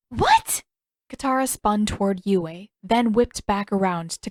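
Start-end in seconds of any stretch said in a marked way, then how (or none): 0.1-0.6 a high, sharp shocked What?; startled. Acted. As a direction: jolt up in disbelief. Katara startled by the accusation.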